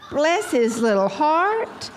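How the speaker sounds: high, slow, hammed-up cooing pity; exaggerated pitying excuse, pitch way up; doting pity. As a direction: stretch it out sweetly, rising on "heart". Acted pitying excuse for husband.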